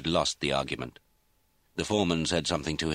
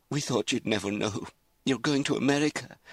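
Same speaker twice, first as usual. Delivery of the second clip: higher-pitched, pleading excuse; tearful; 8 semitones higher than usual. A narrator's reading. Mother excuses herself to angry daughter, tearful. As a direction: plead through the start of tears. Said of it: "Her mother began to cry".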